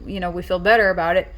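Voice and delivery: usual speaking voice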